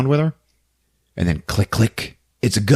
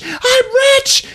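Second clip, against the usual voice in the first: giddy, near tears. Retold or imitated. Barbie grabs the money case.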